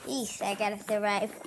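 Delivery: ordinary talk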